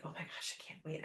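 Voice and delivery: soft hushed giddy whisper; excited whisper. Retold or imitated. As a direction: whisper it like you can barely hold it in. Retold own excited whisper.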